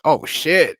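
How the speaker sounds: loud astonished oh shit; surprised curse. Retold or imitated. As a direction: blurt it out, caught off guard. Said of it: "I'm like".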